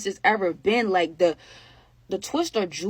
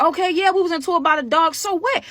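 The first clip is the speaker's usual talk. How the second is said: higher, louder, quick defiant mock retort; 10 semitones higher, 5 dB louder than usual. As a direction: toss it back, daring them. Mocking someone's throw-in-face retort.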